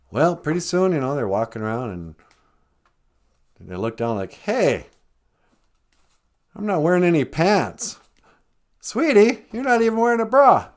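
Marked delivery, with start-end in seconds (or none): 4.3-8.0 high, loud discovery; acted startled realization. Adam discovers he is naked. 8.8-10.7 very high, loud, amused disbelief; mock astonishment. Adam notices Eve is naked.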